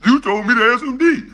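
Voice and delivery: whiny, fast, laughing fake sob; mock crying. Taunting his rival as a crybaby, in a fast whiny fake sob. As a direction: blubber it like a crybaby, mocking.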